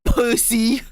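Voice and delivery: teasing jab; mocking jeer. Real, not acted. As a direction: fling the insult back. Echoed taunt.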